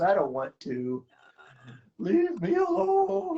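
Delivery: high, slow whiny cat voice; voicing the cat. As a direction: speak for the cat, high and put-upon. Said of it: "He says".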